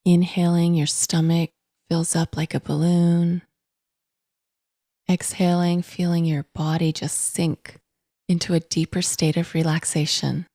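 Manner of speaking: calm, unhurried meditation voice with long pauses; guided meditation. Acted. Podcast host guiding listeners to notice breath and body, calm meditation voice. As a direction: guide gently, in even, spacious phrases.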